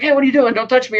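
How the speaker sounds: Johnny V protesting being seized, mock indignant. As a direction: push back at the guards, hammed-up. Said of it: "V's like".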